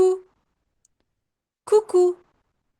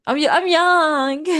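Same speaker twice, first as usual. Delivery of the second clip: mock indignant protest; 9 semitones higher, 6 dB louder, slower than usual. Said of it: "And I was like".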